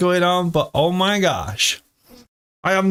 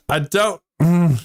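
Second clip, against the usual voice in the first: ordinary delivery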